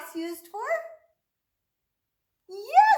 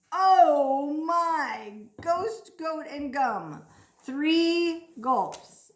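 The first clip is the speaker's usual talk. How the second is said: ordinary talk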